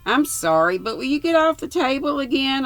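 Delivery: a loud, high rebuke to the man on the table; sharp rebuke. A narrator's reading. Lucy scolding man on table.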